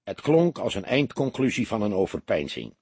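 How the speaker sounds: usual speaking voice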